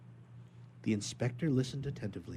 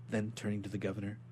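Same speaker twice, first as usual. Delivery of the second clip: plain reading voice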